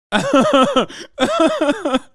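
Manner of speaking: loud high-pitched laugh; high burst of laughter. Real, not acted. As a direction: cackle out loud at the joke. Laughing at chat.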